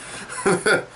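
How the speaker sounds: a voiceless, airy giggle; breathy laugh. Real, not acted. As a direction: laugh under your breath at the joke. Laughing after a crude joke.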